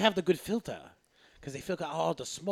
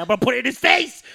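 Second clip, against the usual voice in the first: loud, high cocky boast; mock trash talk, punched up loud; 10 semitones higher, 14 dB louder than usual. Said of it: "we were like".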